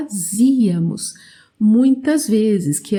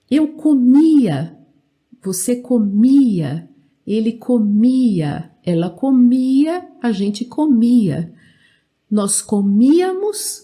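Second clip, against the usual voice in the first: every form of "comia" punched on MI; stress on MI. Shown as a demonstration. Teacher drilling the conjugation for learners, stress on MI. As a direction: drill "comia" forms deliberately, MI long and strong. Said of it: "sempre forte aqui no mi".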